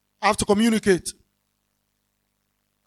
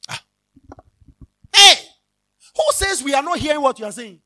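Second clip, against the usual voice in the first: ordinary talk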